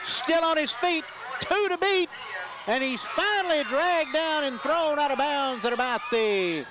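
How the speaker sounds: breathless sideline call; excited play-by-play. Real, not acted. Live play-by-play of a long punt return.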